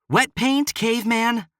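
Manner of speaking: high, loud indignant shout. Acted. She yells at the cowboy grabbing her canvas, high and loud. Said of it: "she shouted".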